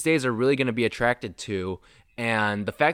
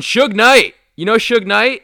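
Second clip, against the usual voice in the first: much higher and louder, springing the surprise name; excited reveal; 10 semitones higher, 9 dB louder than usual. Real, not acted. Host springing a surprise name on his co-host, excitedly. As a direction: land the wild-card name like a punchline.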